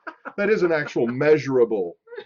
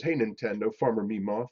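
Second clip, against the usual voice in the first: ordinary talk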